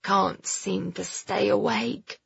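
slow, soft fading mumble; sleepy boy struggling to speak; drowsy mutter. Acted. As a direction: drag the words out, nearly asleep. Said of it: "he muttered".

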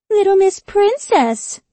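high, slow, spat-out falsetto sneer; squeaky falsetto mockery. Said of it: "she spat".

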